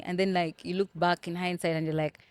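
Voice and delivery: ordinary delivery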